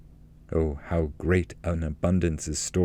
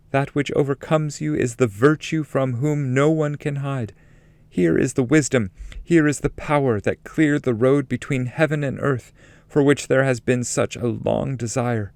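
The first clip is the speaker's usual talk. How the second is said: higher, exalted guiding lady; higher, solemn voice of a holy lady; Beatrice's voice; 7 semitones higher than usual. Acted. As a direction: speak as a radiant heavenly guide, lifted up in pitch. Beatrice speaks within the poem.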